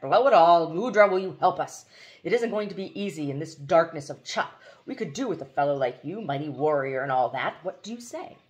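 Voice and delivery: put-on loud, blustering old soldier; booming with overdone respect; hearty general. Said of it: "with great, even exaggerated respect".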